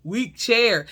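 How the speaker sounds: slow, offhand put-on man's voice; mock dismissive date. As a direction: lightly imitate him blaming the chair. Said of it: "He's like".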